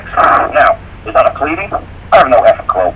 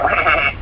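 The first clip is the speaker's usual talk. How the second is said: short imitated bleat, slightly higher; sheep bleat; 4 semitones higher, 4 dB quieter than usual. Shown as a demonstration. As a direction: let out a sheep's baa. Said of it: "That's the sound the sheep makes".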